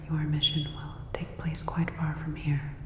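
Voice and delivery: ordinary delivery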